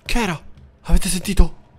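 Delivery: high, loud startled cry. Real, not acted. Gamer hearing a noise in the house, high startled cry. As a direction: jump at a sudden noise.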